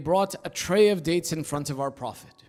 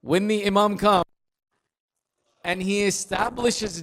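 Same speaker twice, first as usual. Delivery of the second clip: forceful preaching; 3 semitones higher, 7 dB louder than usual. Real, not acted. Preacher builds to climax.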